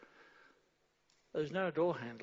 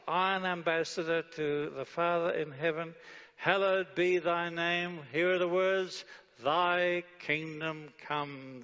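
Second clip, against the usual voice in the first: preacher declaiming the prayer to the congregation; fervent prayer; 2 semitones higher, 5 dB louder than usual. Acted. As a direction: proclaim each word of the prayer.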